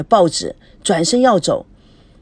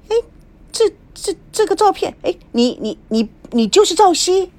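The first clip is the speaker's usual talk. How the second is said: stunned stammer; 10 semitones higher than usual. A narrator's reading. Vendor recognizes Zhao Xi stunned.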